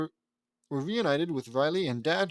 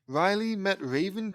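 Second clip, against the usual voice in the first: usual speaking voice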